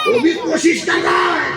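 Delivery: high laughing shout of wonder; rising preacher cry. Real, not acted. Preacher building to a climax before the crowd, high shout.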